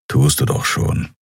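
low, dry growl of a big creature; Kero, dry and low. A narrator's reading. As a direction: answer low and dry, unimpressed. Kero's dry reply. (Kero antwortet trocken, tiefe Stimme)

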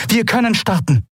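high alien voice, matter of fact; the Shayang tells the crew to launch, high; announcing. A narrator's reading. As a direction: declare them ready. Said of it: "verkündete die Shayang".

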